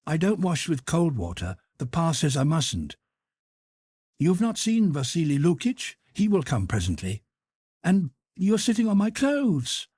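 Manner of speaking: put-on high boyish voice, eager; a small son prattling happily; chattering little boy. A narrator's reading. Boy chatting to his returned mother, high and eager.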